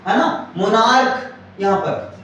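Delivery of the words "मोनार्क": मोनार्क ending in a K sound; pronunciation of monarch. Shown as a demonstration. Teacher modelling the word for students, मोनार्क with final K. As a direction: say मोनार्क with hard K, not CH. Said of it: "इसको actually बोलते हैं मोनार्क".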